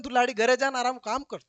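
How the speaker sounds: ordinary delivery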